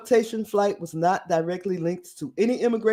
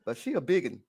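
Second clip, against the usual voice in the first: laughing; 3 semitones lower, 8 dB quieter, faster than usual. Real, not acted. Laughing while saying it.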